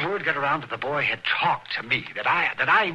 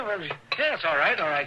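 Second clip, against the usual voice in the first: usual speaking voice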